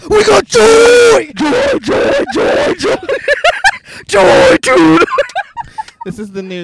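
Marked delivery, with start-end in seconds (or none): 0.1-5.0 joyful shout. Pretend. Shouting joy in the car.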